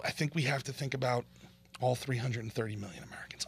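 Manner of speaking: mock-timid whisper; sarcastic whisper. Real, not acted. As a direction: hush it like a guilty confession.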